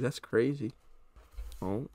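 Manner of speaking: ordinary talk, nothing marked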